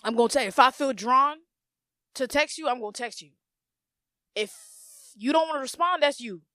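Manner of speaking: normal talking voice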